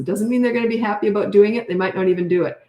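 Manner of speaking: usual speaking voice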